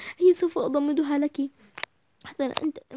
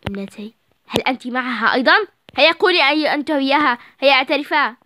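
louder, stern interrogation; scolding mother; 6 dB louder than usual. Acted. Mother scolding her daughters for lying, angry. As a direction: demand the truth.

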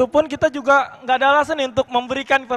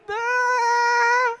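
high dreamy sigh; swooning sigh; 13 semitones higher than usual. Acted. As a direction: let out a lovesick sigh.

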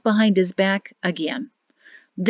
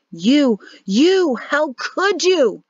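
higher, louder and slow, cut to the bone by betrayal; outraged accusation; 7 semitones higher, 3 dB louder, much slower than usual. Acted. Captive confronting the man who betrayed him, outraged and slow. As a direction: spit it out in disbelief and fury.

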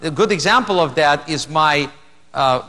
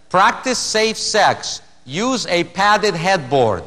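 slow punchline; slower than usual. Real, not acted. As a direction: draw out the shirt slogan slowly. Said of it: "T-shirt that says".

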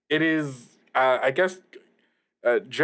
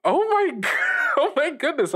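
high, laughing disbelief; overwhelmed delighted shock; surprised; 13 semitones higher than usual. Acted. Host shocked to hear teacher.

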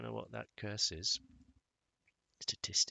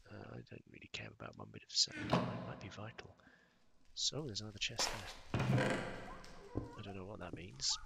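ordinary talk

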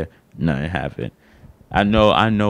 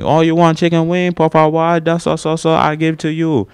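high, loud Chinese takeout seller; exaggerated Chinese-accented sales patter, pitched way up; mock Chinese accent; 10 semitones higher, 5 dB louder than usual. Store worker answering a chicken wing order, mock Chinese accent.

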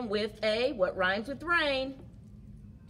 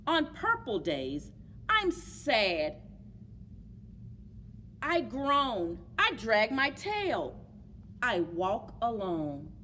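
put-on reading to children. A narrator's reading. Teacher reading My Many Colored Days, storytime voice. Reads picture book aloud.

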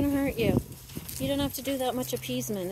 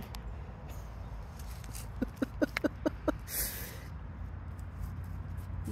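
breathy laugh; 13 dB quieter than usual. Real, not acted. Laughing at the dogs.

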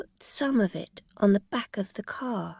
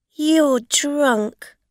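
accusing; 7 semitones higher, 8 dB louder than usual. A narrator's reading. Rosa accusing the driver.

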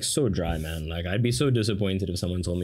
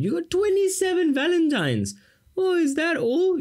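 put-on spoilt girl voice. Teacher mocks Elizabeth's ungrateful reply in a very high squeak. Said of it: "her lack of gratitude".

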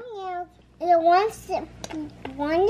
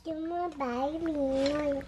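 soft echo; 5 semitones lower, 6 dB quieter than usual. Real, not acted. Con lặp lại nhỏ.

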